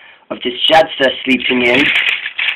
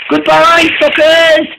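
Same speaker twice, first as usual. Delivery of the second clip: high gleeful goodbye; mocking farewell much higher; taunting shout; 13 semitones higher than usual. A narrator's reading. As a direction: jeer at the diesels, pitched up. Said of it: "shouted Timothy".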